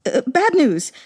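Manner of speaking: high frantic servant cry; cried out. Acted. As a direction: yell the alarm again. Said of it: "The servant cried again".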